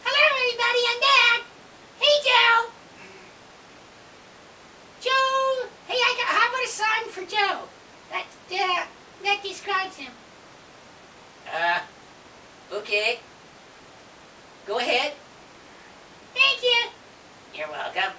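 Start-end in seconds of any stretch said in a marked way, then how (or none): 0.0-10.1 squeaky raccoon voice. Pretend. Lucky raccoon returns. 16.3-16.9 squeaky raccoon voice. Pretend. Lucky raccoon voice.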